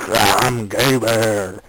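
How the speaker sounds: slow, drawn-out bear voice; stretched mock-bear roar. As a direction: growl out the words like a storybook bear. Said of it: "the bear turns on him, and it says".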